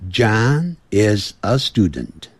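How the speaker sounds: "John is a student" slowly, word by word; teacher's drawn-out model of "John is a student"; slow model sentence. Shown as a demonstration. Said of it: "你就这么记".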